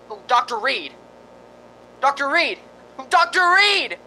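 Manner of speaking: rising high frantic shouts; calling for help. Acted. Smith shouting upstairs for Doctor Reed, rising panicky calls.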